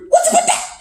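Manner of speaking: very high squeaky mimicked catchphrase; imitating a quick falsetto quip; quick catchphrase imitation. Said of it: "it says real quick".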